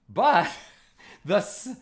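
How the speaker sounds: ordinary talk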